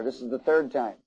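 usual speaking voice

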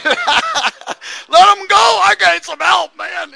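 high loud drawn-out yell; shouted plea for help. Retold or imitated. Preacher imitating us calling for rescue, a loud high yell. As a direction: bellow it like a panicked man in trouble. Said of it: "I know we would have".

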